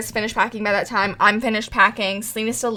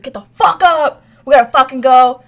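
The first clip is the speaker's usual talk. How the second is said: louder, higher mock yell; yelling order; 3 semitones higher, 8 dB louder than usual. Mark orders the sleeping friend up, mock yell. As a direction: bark it like dragging someone out of bed.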